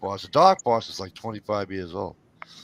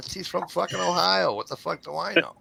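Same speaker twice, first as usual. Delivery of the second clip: higher-pitched retold exasperation; exasperated retort; 7 semitones higher than usual. Husband answering wife about the misspelling, exasperated. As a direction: toss it back like an irked husband.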